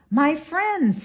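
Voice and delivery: ringing, salesman-bright greeting; clear keen announcement. Acted. Stranger greets the moping Sneetches, clear and keen. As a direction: announce it like a travelling showman. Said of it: "he announced in a voice clear and keen".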